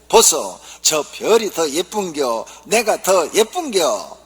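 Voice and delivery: slow Gyeongsang wife asking her husband; Gyeongsang dialect wife. Acted. Gyeongsang wife fishing for a compliment, slow dialect. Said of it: "경상도 아내가".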